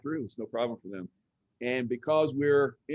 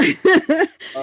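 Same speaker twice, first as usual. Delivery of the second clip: laughing; 13 dB louder than usual. Real, not acted. Breaks up mid-question.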